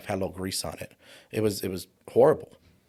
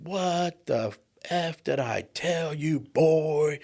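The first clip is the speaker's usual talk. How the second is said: slow, menacing father's voice, much higher; hammed-up drill-sergeant dad bearing down on his son; angry father; 7 semitones higher, much slower than usual. Dad catches boy chasing girl.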